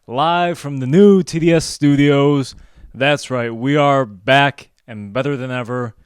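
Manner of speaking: big, loud comeback announcement; hype show intro. Acted. Host to audience, we are back, loud hype intro. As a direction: announce the show's return at full volume.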